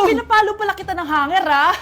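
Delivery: loud, indignant outburst; angry scolding. Acted. Mother confronting child over test answer, angry. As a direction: throw the accusation back loudly.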